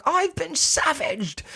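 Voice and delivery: very high, slow, lisped mock complaint; hammed-up high voice with a lisp on "savaged"; weird lisp. Host mimicking Vera after the bite, high slow lisp. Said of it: "like, this weird lisp".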